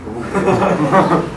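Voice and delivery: short laugh at oneself; self-mocking chuckle. Real, not acted. Teacher admitting to the same trouser colour, self-mocking chuckle. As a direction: chuckle at your own embarrassing confession.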